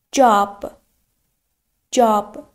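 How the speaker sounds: جاب with a drawn-out "aa"; جاب said singly, long open "aa" in the middle; long vowel. Shown as a demonstration. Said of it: "یه آی کشیده داریم".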